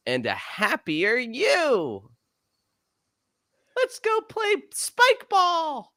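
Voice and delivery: put-on high, bouncy little beach kid; kid surfer voice. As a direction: read it like an excited kid at the beach. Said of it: "I'm gonna do, like, a kid surf-, surfer".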